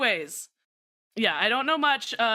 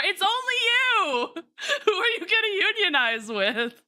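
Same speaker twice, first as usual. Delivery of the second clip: laughing tease; 9 semitones higher than usual. Real, not acted. Teasing lone mod.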